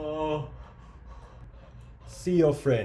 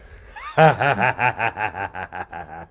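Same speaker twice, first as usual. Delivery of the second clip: evil laugh; 4 semitones lower, 4 dB louder than usual. Acted.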